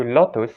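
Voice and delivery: "lotus" said "LOT-toos"; short-o "lotus"; Indonesian-style "lotus"; Indonesian pronunciation. Shown as a demonstration. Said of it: "See the difference".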